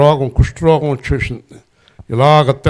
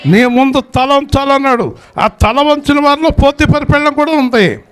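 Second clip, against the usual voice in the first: full-force preaching; 11 semitones higher than usual. Acted. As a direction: preach it out to the congregation, soaring high. (గట్టిగా, ఉచ్ఛస్వరంలో ప్రసంగం)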